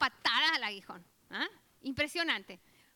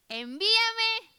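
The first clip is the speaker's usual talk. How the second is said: slow, loud, higher cry of send me; eager call; 8 semitones higher, 9 dB louder, much slower than usual. Retold or imitated. Ananías volunteering to the Lord, calling out loud. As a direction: call out to be sent. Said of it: "dijo Ananías".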